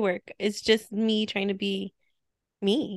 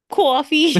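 put-on coffee as "kwawfee" in Boston accent, loud and high; mock Boston accent; 7 semitones higher, 7 dB louder than usual. Joking about Boston coffee, Boston accent. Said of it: "that Boston accent".

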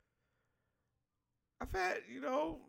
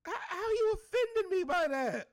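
high, loud, indignant question; exasperated high-voiced demand; incredulous challenge; 10 semitones higher, 6 dB louder than usual. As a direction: pitch it way up in disbelief. Indignant challenge to the imagined offender.

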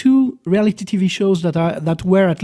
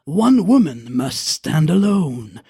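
deep, slow trailer voice; movie trailer voice; 3 semitones lower than usual. Acted. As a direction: sell it like an epic trailer. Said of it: "made-up intros".

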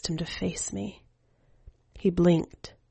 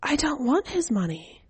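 put-on higher, light boyish voice; child's voice; 9 semitones higher than usual. Keiichi asks the skeleton woman for news, in a higher boyish voice. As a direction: voice the boy, eager and casual.

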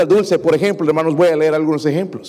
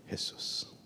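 whispered Jesús; 21 dB quieter than usual. Acted. Prayer verse fading to a breath.

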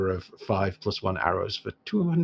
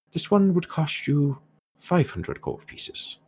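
slow Irish price, trailing to a whisper; name the price in Irish, dropping to a whisper; dwarf shopkeeper in an Irish accent quoting a steep price; 8 semitones higher, slower than usual. Acted. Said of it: "I've gone entirely Irish now".

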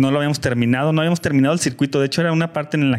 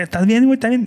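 high, louder urgent questions; retold worried friends, voice raised high; alarmed checking; 10 semitones higher, 4 dB louder, faster than usual. Bystanders asking fallen friend if he's okay, alarmed. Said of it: "la banda fue como de".

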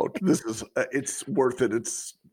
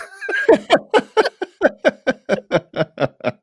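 big shrieking laugh; loud high laugh; 4 dB louder than usual. Real, not acted. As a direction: burst of high-pitched laughter. Reacts to Michael Scott quote.

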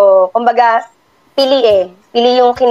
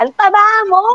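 high, mock yell from the crowd; heckler's shout; 10 semitones higher than usual. Crowd heckler yelling at the dancing mom, high mock shout. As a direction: fling the insult up at the stage. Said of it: "sinisigawan ako sa baba".

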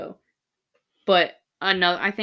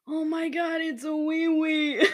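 high, slow, teary with laughter; slow thrilled exclamation; 7 semitones higher than usual. Retold or imitated. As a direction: draw it out, nearly crying laughing. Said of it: "I was like".

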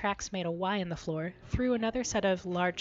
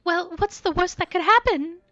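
higher, louder, lightly careless; breezy flippancy; 10 semitones higher, 8 dB louder than usual. Acted. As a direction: shrug off the danger. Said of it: "I totally misgauged that".

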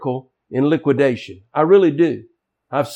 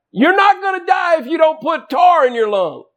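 higher, shouted scolding; mock scolding shout; 14 semitones higher than usual. Wife shouting at smoking husband, mock scolding. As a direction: hammer it home like a fed-up wife.

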